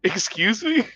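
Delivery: high startled excuse me; retold shock at being called stupid. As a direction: act taken aback. Shocked reply to her insult.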